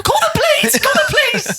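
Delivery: very high, wailing mock panic; squeaky panicked cry. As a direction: wail it in a tiny squeaky voice. High crying mock plea.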